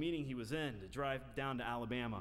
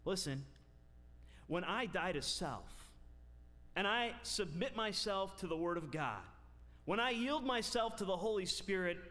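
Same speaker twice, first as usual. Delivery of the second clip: full-force preaching; 4 semitones higher than usual. Acted. Preacher driving home holiness to his congregation, full force. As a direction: proclaim it to the whole church.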